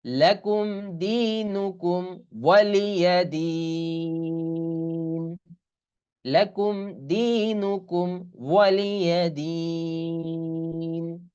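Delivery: slow melodic tajweed chanting; Quran recitation. Shown as a demonstration. Teacher reciting the surah for students, slow chant. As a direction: draw the vowels out in recitation.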